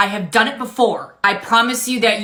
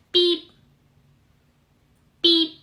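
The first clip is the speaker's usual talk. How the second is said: high mock scanner beep; 8 semitones higher than usual. Cashier voicing scanner.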